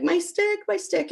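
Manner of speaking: put-on high wailing child's voice; crying toddler. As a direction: whine it like an upset two-year-old. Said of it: "my son immediately started to cry".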